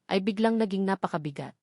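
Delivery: ordinary delivery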